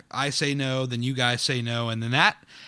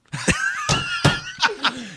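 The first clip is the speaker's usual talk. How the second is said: real, shrieking laugh. Real, not acted. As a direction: crack up at your own answer. Laughs after admitting it never happened.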